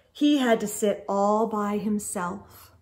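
plain reading voice

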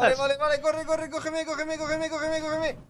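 high, urgent begging; panicked pleading. Real, not acted. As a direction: beg frantically to be caught. Falling, begging partner to catch.

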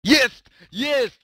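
loud, high real cheer; two punched, celebratory exclamations; triumphant cheer. Real, not acted. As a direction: shout it in triumph at the roll. Celebrates a dice roll.

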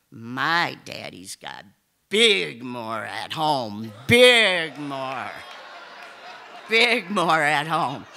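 a hint of a small kid proudly blurting it, slowly; imitating a small child. Little son quoted via principal.

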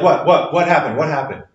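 quick, worried what happened; alarmed. Retold or imitated. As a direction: ask in sudden alarm. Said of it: "Usually you're like".

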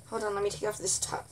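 normal talking voice

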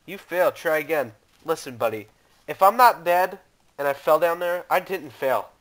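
ordinary talk, nothing marked

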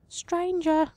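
high, faint calling voice; a high, faint tree voice calling to a lost traveler; eerie tree voice. Acted. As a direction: call out like a ghostly tree spirit. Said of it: "The voice is faint yet echoing".

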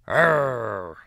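a short loud pirate growl; firm growl. Acted. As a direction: growl him off, loud and high. Olivia growls at her brother.

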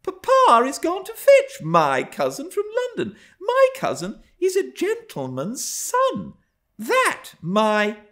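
put-on high, loud childish distress; protest, upset, and break off mid-thought; upset, near tears. A narrator's reading. Young Cathy upset at the notion of such a cousin, high distressed voice. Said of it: "she stopped and wept outright".

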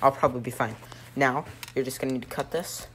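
ordinary talk

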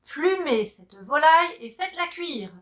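a touch of a commanding captain; captain orders the hen cooked, commanding; captain's order. Acted. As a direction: bark the order to the crew. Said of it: "ordonne le capitaine".

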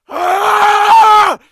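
high, very loud held yell; long scream. Acted. Actor retrying a take, long scream. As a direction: holler at full power, holding it.

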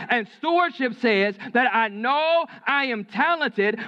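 high, forceful sermon delivery; emphatic preaching. Real, not acted. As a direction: drive the point home to the congregation.

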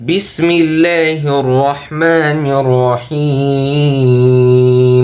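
slow, melodic tajweed chant with long drawn vowels; Quran recitation. Acted. Teacher reads the whole surah to students, melodic chant.

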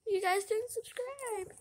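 high whiny pretend sob; mock crying. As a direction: complain as if close to tears. Pretend upset at viewers not subscribing.

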